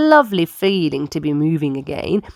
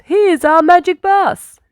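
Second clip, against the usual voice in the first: high, loud call across the hangar; 12 semitones higher, 5 dB louder than usual. A narrator's reading. Mechanic calling across the hangar, high and loud. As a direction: call out to be heard far off. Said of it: "he called".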